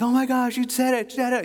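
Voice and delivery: put-on high, loud burst of delight to a baby; thrilled dad. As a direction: cheer on the baby's first word as if it's a miracle. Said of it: "I'm like".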